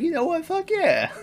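playful imagined celebrity cheer; mock enthusiasm. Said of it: "if he's like".

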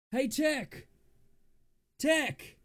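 high, urgent call. Acted. Actor in recording calls out to Tech, high calling shout. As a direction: call sharply for someone's attention.

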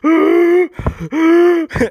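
repeated high, loud hoots of panic; hammed-up high panicked noise; freaked-out cry. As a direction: imitate freaking out with high wails. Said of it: "I'm freaking out".

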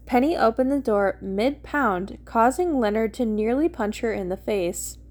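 plain reading voice

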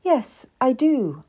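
slow, wary admission; guarded. A narrator's reading. Cat admits she serves the queen, guarded. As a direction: answer carefully, drawn out. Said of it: "I said guardedly".

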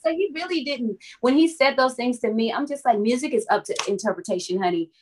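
normal talking voice

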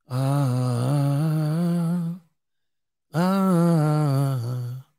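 soft ghostly ah sounds; ghostly moan. Retold or imitated. Ghost moaning in the dark.

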